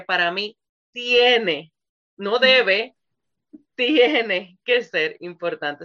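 TIENE drawn out slowly and loud, set against "no debe"; emphatic stress on TIENE. Real, not acted. Host teaching what matters to God, emphatic stress on TIENE. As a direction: punch "tiene", pausing around "no debe". Corrects "should" to "has to.

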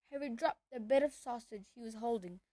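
usual speaking voice

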